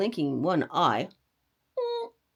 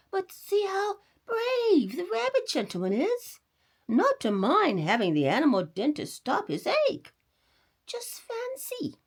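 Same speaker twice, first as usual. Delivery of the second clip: much higher mother's voice; admiring mother; 11 semitones higher than usual. A narrator's reading. Mother points out the brave rabbit, high voice. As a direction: praise the brave rabbit to the boy.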